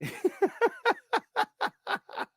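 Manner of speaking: a high, breathy burst of laughter; real laugh at his own story. Real, not acted.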